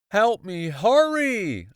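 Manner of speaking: high, loud scream. Acted. Boy screaming at the rescuer to hurry. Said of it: "I screamed".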